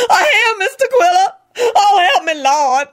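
high-pitched hysterical plea; wailing. A narrator's reading. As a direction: wail for help. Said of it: "Lucretia wailed unrepentantly".

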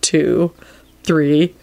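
measured, evenly spaced count; deadpan inward count; counting to himself. A narrator's reading. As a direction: count silently along, one beat each. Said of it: "started counting to himself".

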